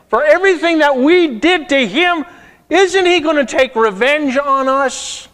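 frantic brothers sure they are doomed; a voice that climbs high and loud in terror; panicked brothers. Acted. Brothers voiced fearing revenge.

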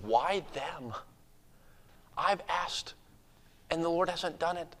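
soft, hurt muttering to oneself; cynical inner grumble. Acted. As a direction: quietly question why others got answered. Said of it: "being cynical towards others".

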